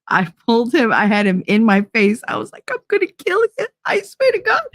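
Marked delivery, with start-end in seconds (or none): 2.7-4.6 very high, strained mock-furious threat; retold threat. Telling how she grabbed him over the pepper, high threatening voice. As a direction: re-enact threatening him, voice pitched way up.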